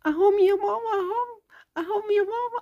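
put-on excited little girl. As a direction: call out like a kid spotting family. Child spots arrivals.

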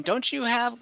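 usual speaking voice

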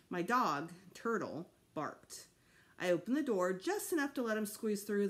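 usual speaking voice

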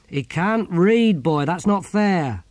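high, loud, slow reproach; chiding defence. Acted. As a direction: scold the younger one for unfairness. Philosopher scolds Ern in defence.

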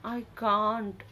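ordinary delivery, nothing marked

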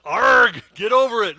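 loud, high pirate bellow of Arg; hammed-up pirate roaring the punchline; pirate shout. Pastor roars the pirate punchline to the congregation, loud pirate shout. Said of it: "what did pirates call Noah's boat".

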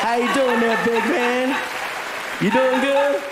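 drawling movie-star impression, projected; Matthew McConaughey. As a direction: greet him in the star's accent. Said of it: "he looks at me and he says".

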